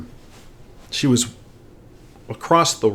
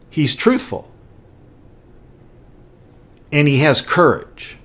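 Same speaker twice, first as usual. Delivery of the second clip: put-on a touch higher and louder, the mayor lecturing a kid; retold mayor speaking down to a boy, higher and louder; imitating the mayor; 6 semitones higher, 5 dB louder than usual. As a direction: play the mayor praising the boy's father to his face.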